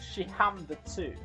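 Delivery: ordinary delivery, nothing marked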